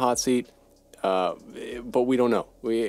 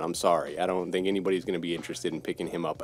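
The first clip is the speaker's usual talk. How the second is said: ordinary talk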